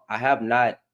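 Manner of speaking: normal talking voice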